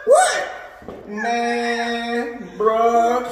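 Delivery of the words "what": much higher, rising to a loud squeak; panicked kid squealing at mom; startled protest. Retold or imitated. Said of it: "I'm like".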